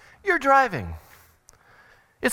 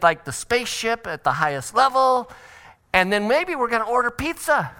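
otter dad; 3 semitones higher than usual. Acted. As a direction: stretch it out like a laid-back fun parent. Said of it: "the otter was like".

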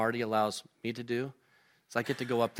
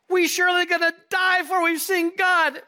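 high, loud, hammed-up panicked shout; overreacting panic; 8 dB louder than usual. Manoah to his wife, hammed-up panicked shout. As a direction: wail it out as a mock overreaction. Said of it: "is overreacting".

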